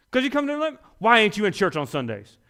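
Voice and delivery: high, loud indignant scold; scolding church lady. Retold or imitated. Church diner scolding a waitress for working Sundays, indignant and loud. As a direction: scold her like a self-righteous churchgoer. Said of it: "church people scolding waitress".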